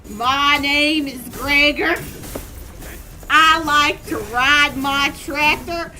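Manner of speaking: put-on loud, slow Southern accent; exaggerated country Southern drawl; Gregor. Streamer imagining a Southern game character, loud slow drawl. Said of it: "you know who would be Southern".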